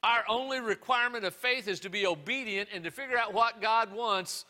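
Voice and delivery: loud, high, fervent preaching; full-force preaching. Acted. Pastor preaching to his church, loud and fervent.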